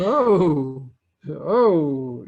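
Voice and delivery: loud, re-enacted "oh" of astonishment; big retold oh of wonder; awed realisation. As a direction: act out being floored by a revelation. Re-enacting his stunned realisation.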